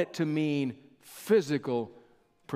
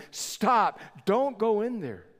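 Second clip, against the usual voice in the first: raised, higher mock shout of stop; retold shouted warning; 5 semitones higher, slower than usual. Crowd behind rescuer yelling at him to stop, mild mock shout. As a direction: call him back from the fire, lightly acted. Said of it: "to the shouts and the cries".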